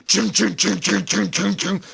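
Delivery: high quick ching-ching gunfire imitation; machine gun noise. Telling friends about the gunner firing nonstop, imitating the gun. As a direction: rattle it off like a gun going cyclic.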